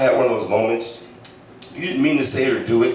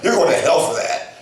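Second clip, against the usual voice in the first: mock scolding friend; 6 semitones higher than usual.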